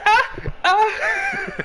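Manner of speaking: very high squeaky cries of pain; mock pained yelps. Son whipped in the background, high mock yelps. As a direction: yelp as if each belt stroke lands. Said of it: "all you hear is".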